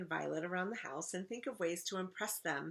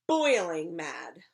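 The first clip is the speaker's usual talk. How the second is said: loud, drawn-out seething; slow, emphatic storybook fury; boiling mad; 10 dB louder, much slower than usual. Acted. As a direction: stretch the words out with anger. Child declares her rage.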